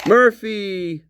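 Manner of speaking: high, drawn-out dog call; imitated calling out. Imitating officers heard calling the dog Murphy, calling out. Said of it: "hear them in the background say Murphy".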